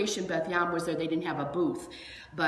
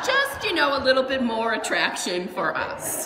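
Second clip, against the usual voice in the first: laughing; 7 semitones higher, 7 dB louder than usual. Real, not acted. Laughing joke about car magnets.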